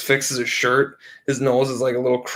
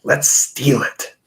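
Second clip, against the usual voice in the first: scheming whisper; much slower than usual. Retold or imitated. John proposing the theft.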